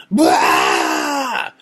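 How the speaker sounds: sudden low yelled burst; startled shout of fright; startled scream. Retold or imitated. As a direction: jolt awake screaming. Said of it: "the craziest scream".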